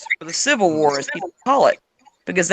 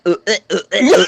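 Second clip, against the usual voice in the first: imitated hairball heaving, building louder; cat retching imitation; 4 dB louder, much faster than usual. Imitating the cat at one thirty in the morning, retching noises. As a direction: mimic a cat hacking, each heave harder. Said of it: "nothing worse than that sound".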